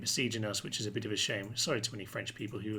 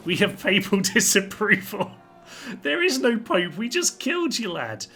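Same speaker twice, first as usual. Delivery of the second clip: much higher and louder, crowing with glee; gleeful gloating; 8 semitones higher, 8 dB louder than usual. Real, not acted. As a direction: gloat loudly that the Pope is dead. Gloating over the dead Pope.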